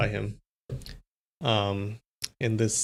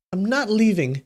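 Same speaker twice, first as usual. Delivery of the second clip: higher, more insistent repeat; higher insistent refusal; 7 semitones higher than usual. Acted. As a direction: insist again, pitched up.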